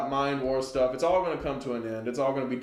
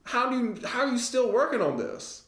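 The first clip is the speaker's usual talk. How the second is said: much higher, incredulous mock outrage; mock disbelief; 9 semitones higher than usual. Driver mocking endless road work, high and incredulous. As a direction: squeak out how is this still unfinished. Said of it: "And you're like".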